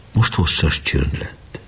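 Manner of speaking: ordinary delivery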